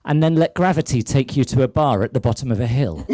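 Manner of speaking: usual speaking voice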